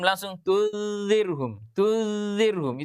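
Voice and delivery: "tunzirhum" slow with the nun fully swallowed; merged idgham demo. Shown as a demonstration. Teacher showing the wrong fully merged reading. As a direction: melt the nun straight into zirhum. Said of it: "itu melebur".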